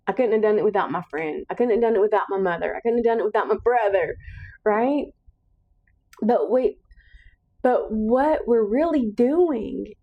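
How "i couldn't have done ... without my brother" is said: quick run of mock excuses; hurried, self-deflecting list; brushing it off. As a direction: rattle off the excuses, rushed and dismissive. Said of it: "We brush it off".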